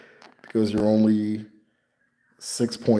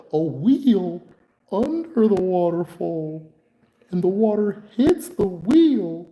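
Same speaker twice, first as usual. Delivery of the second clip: high, slow, wide-eyed pitch of an invention; drawn-out high voice, proud of the idea; imitating the patient explaining his waterwheel; 10 semitones higher, slower than usual. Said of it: "This is exactly how he sounds".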